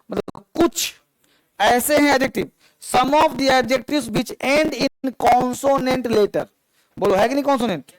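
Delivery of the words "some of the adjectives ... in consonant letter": very slow, word by word dictation. Shown as a demonstration. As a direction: spell it out slowly so they can write. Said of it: "dictating rule for students to write". (धीमे-धीमे बोलकर लिखवाना)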